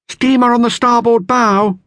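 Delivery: high, loud lookout call. A narrator's reading. Lookout calling a steamer to the crew, loud call. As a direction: sing out the sighting across the water. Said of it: "called the lookout".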